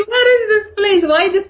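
high-pitched mock bewilderment through laughter; laughing dismay. Newcomer recalling London's endless rain, laughing dismay. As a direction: wail it, laughing at the memory.

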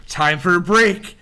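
dwarf checking his wrist, declaring break time; dwarf announcing. Acted. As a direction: announce it like a punchline. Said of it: "He looks at his wrist and goes".

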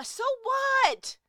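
shouted dismissal. Real, not acted. Cutting off a stuck person's excuse, shouting. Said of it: "Yes, I'm hyped".

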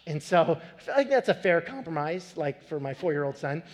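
usual speaking voice

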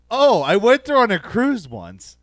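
loud, slow, clueless tourist enthusiasm. Retold or imitated. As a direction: proudly overdo the oblivious small talk.

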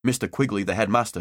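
usual speaking voice

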